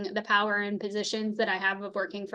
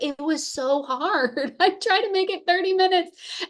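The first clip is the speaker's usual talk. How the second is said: laughing mock protest; 8 semitones higher than usual. Wife admitting to husband how hard not cussing was, laughing protest. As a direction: stretch out the complaint, then laugh.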